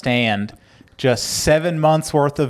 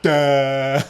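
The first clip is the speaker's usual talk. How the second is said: dumb guy voice. Retold or imitated. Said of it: "And he's just like".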